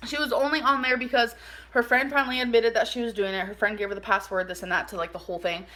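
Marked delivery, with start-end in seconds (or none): none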